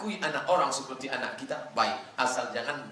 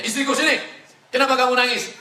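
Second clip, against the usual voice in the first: much higher and louder, calling across the house; 11 semitones higher, 8 dB louder, faster than usual. Acted. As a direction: call the wife over, loudly concerned. Husband calls his crying wife.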